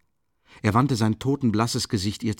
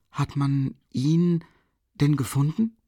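high, very slow and hesitant; timid question; 6 semitones higher, slower than usual. A narrator's reading. Sonja asks Raskolnikov about the killer, timid, high and slow. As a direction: ask as if afraid of the answer. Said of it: "fragte sie zaghaft".

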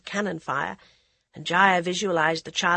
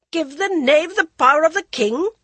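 loud, high furious queen; angry; 10 semitones higher, 8 dB louder than usual. A narrator's reading. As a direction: cut him off in anger. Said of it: "interrupted angrily".

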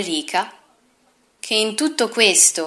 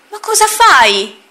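high, slow, shocked question; a lightly voiced baffled uncle; astonished; 11 semitones higher, 6 dB louder, slower than usual. Uncle scolds brother-in-law.

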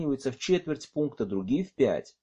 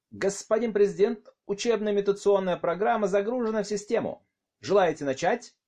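raised, higher formal report; loud announcement; 8 semitones higher than usual. Acted. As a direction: announce it loudly to the room. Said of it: "обернулся и объявил". (громкое объявление)

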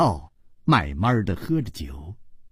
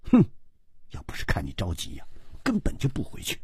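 soft, quick, with a little huff; mild teasing; 7 semitones lower, 8 dB quieter, much faster than usual. Acted. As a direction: tease lightly under the breath. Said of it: "半冰也笑着说". (轻声笑着打趣)

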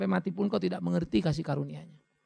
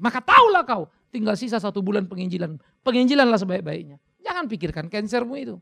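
loud, high scolding from the pulpit; stern preaching rebuke; 7 semitones higher, 8 dB louder than usual. Acted. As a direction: hammer the rebuke home. Rebuking the fearful believer. (menegur dengan keras dari mimbar)

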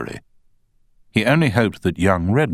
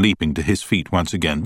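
plain reading voice

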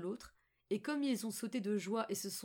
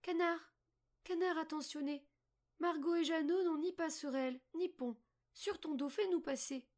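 put-on high, lilting girl's appeal; little girl calling; 8 semitones higher than usual. A narrator's reading. Margot asks the duck to carry them, high girlish call. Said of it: "Et elle appela".

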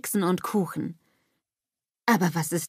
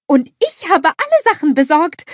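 high, loud and proud; proud announcement; 9 semitones higher, 9 dB louder than usual. A narrator's reading. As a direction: proclaim it loudly with pride. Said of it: "verkündet Olaf stolz".

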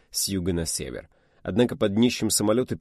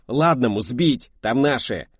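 loud, slow, higher desperate demand; furious urgent order; 5 semitones higher, 6 dB louder, slower than usual. Acted. Ershov demanding the patrol ship shoot them down. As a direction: demand it in horror and rage. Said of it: "В ужасе и ярости засипел Ершов".

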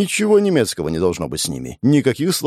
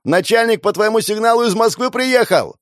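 high, loud yelling; loud silly shout; 11 semitones higher, 4 dB louder than usual. Acted. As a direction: shout it so a deaf woman hears. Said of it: "прокричал Жуков дурным голосом".

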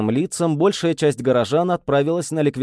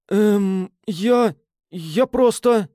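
slow, high stammering; flustered, stumbling over words; babbling; 8 semitones higher, much slower than usual. A narrator's reading. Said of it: "лепетал он".

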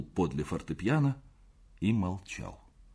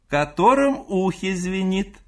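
loud, high-pitched repeated call; крик матери; 10 semitones higher, 8 dB louder than usual. A narrator's reading. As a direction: shout it to the other room, higher and louder. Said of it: "кричала мать". (кричит громко и высоко)

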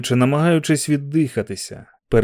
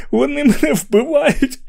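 begging between fits of laughter; high laughing plea; hysterical laughter; 13 semitones higher than usual. Acted.

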